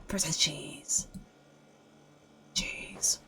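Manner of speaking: breathy whisper, slow and soft. Real, not acted. Player whispering food names while scavenging, whispered.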